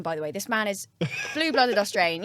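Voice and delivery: ordinary talk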